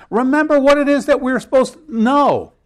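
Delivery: excited. Real, not acted. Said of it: "answering with emphasis".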